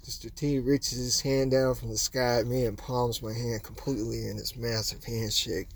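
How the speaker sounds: ordinary talk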